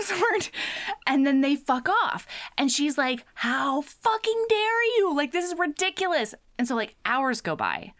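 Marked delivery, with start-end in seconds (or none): 3.3-6.3 mock outrage. Locked-up girl to the bullies who left her, mock outrage. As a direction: play affronted, pitch way up.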